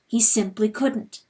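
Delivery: usual speaking voice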